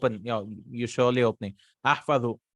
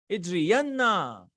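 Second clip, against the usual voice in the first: Ijriyanna drawn out slowly and pitched high; emphatic command; 4 semitones higher, much slower than usual. Shown as a demonstration. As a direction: stretch the doubled n in Ijriyanna for emphasis.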